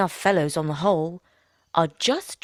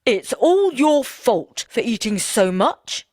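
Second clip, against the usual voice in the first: blaming; 5 semitones higher, 5 dB louder, slower than usual. Acted. Couple quarrelling.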